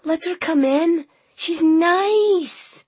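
put-on high, light child voice; a small girl pleading gently; young girl voice. A narrator's reading.

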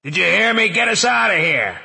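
high, loud urgent shout. Acted. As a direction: bellow up from the manhole. Shouting up from below.

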